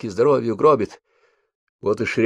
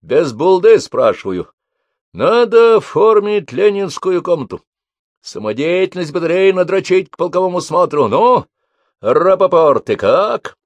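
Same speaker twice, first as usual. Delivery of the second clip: shouting drill sergeant; 9 semitones higher, 6 dB louder than usual. A narrator's reading. Sergeant demands volunteers.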